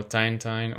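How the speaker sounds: Tintin as "tyne-tyne"; Tintin with an "eye" vowel in both halves; alternative pronunciation guess. Shown as a demonstration. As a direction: say Tintin with a long i, rhyming with nine. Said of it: "if you pronounce it".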